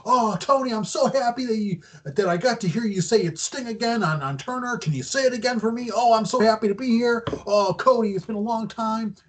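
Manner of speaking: mock gushing fanboy, pitched well up; gushing excitement. Sting gushing to Tony and Cody in the ring, gushing excitement. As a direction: imitate someone thrilled, voice high and running on. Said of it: "He's all excited".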